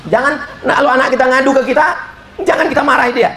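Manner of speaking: rising sermon urgency; urgent preaching. Acted. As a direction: rush it out high and urgent. Preacher rises to urgent pitch.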